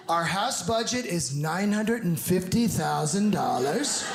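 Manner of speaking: slow, hesitant millennial with rising line ends; millennial house hunter. Acted. Millennial husband introducing himself on a house show, slow uptalk. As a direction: drag it out with uptalk, like a clueless young buyer. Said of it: "you'll see a millennial couple".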